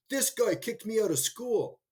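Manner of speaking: a retold loud call across the counter; loud announcement. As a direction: announce it for everyone to hear. Man announces to the whole room.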